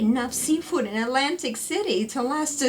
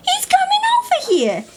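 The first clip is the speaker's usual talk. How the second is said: alarmed; 3 dB louder than usual. Acted. Ex approaching table.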